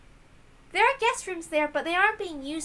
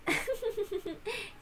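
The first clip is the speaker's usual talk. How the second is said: soft amused laugh; 8 semitones higher, 9 dB quieter than usual. Acted. [name] laughs softly.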